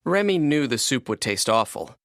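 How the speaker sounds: usual speaking voice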